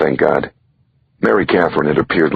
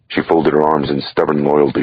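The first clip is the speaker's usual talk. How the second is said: usual speaking voice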